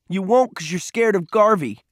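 high, goading accusation; scornful boy's challenge; angry taunt. A narrator's reading. Peter taunts Cole in quarrel.

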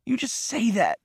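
high-pitched, tearful accusation; sobbing. A narrator's reading. Crying boy doubts his attacker's promise, sobbing high. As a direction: protest through sobs, voice pitched high. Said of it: "Peter sobbed".

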